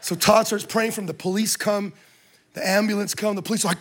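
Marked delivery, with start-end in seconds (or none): none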